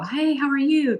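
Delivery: high, sing-out friendly hello; cheery greeting. Retold or imitated. Traveler mimicking how people greet strangers on the road here, cheery.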